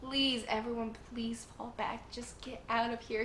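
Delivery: hushed, imitated begging; soft pleading. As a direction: plead softly, as if acting out his plea. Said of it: "just begging for everyone to leave".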